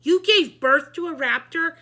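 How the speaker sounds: high, loud disbelief; incredulous. A narrator's reading. Board president stunned at the author, high and loud. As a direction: ask in shocked disbelief.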